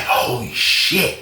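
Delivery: slow, soft stunned mutter; awed murmur. Retold or imitated. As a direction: let it drop out slowly in shock. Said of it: "Josh was like".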